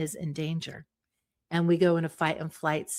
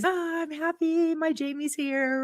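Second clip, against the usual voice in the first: higher, excited dog voice greeting its owner; happy dog voice; 8 semitones higher than usual. Pretend. Dog greeting Jamie coming into the room, high excited dog voice. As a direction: voice the dog overjoyed its owner is home. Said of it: "the dog sees you".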